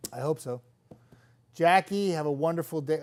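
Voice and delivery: ordinary talk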